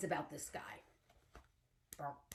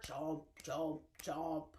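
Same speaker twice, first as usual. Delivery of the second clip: mock alligator chomp; 8 semitones lower, 7 dB quieter, slower than usual. Acting out alligator jaws.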